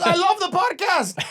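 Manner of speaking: high, loud gushing fan voice; excited fan. Retold or imitated. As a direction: gush like an overexcited fan. Fan quoted from car.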